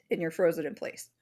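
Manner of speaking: usual speaking voice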